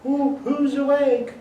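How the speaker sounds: high, slow hooting female owl; answering owl hoot. Pretend. Female owl answering her mate, high hooting. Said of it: "you'll hear back".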